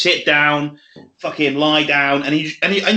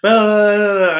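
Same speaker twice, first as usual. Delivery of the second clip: higher, louder babble mimicking a talker; mocking chatter; 5 semitones higher, 5 dB louder than usual. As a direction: imitate someone yammering on at you. Mimicking someone talking at him.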